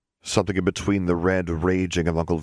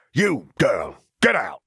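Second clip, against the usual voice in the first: higher, louder barked order; a bullying uncle ordering the girl out; commanding uncle; 5 semitones higher, 3 dB louder, slower than usual. Acted. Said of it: "Uncle Vernon's commands".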